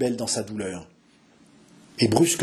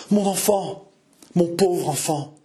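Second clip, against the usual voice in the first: higher-pitched cry of a despairing mother; 4 semitones higher, slower than usual. A narrator's reading. Jeanne cries out for her unborn child, in despair. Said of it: "condensa tout son désespoir".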